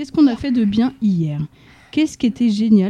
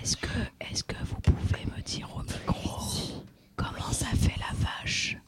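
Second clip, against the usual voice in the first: slow unvoiced hush; whispering; 15 dB quieter than usual. Shown as a demonstration. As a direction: whisper the question slowly. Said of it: "quand on parle tout bas comme ça".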